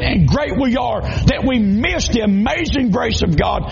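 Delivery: fiery preaching. Acted. Preaching at full force.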